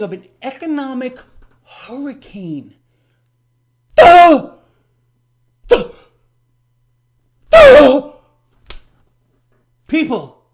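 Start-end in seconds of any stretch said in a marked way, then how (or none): 4.0-8.1 very loud, high sarcastic yell; mocking shout. Real, not acted. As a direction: bellow it at the obvious. Shouting at obvious news.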